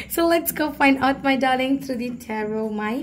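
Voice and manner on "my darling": normal talking voice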